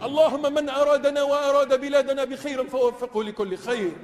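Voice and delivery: ordinary delivery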